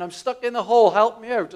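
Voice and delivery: high, loud call to a friend; shouting for help. Retold or imitated. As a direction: cry up out of the hole. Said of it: "he shouts to his friend".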